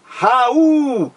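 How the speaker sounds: "Raul" drawn out, leaning long on the U; syllables stretched. Shown as a demonstration. Teacher showing students the hiatus, "Raul" slowly stretched. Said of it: "Vamos separar a sílaba".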